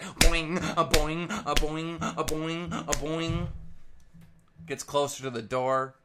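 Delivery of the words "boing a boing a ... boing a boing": high bouncy boing sounds; cartoon pogo boings, pitched up; goat boing noises. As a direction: bounce out each boing like a spring. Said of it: "He's like".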